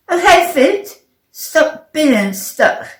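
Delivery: firm order, slowing at the end; commanding own feet. A narrator's reading. Stuck player ordering their own feet, commanding.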